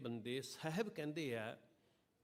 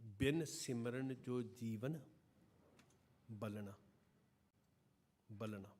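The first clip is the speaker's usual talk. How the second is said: verse recitation; 2 semitones lower, slower than usual. Acted. Slow recitation, fading echo.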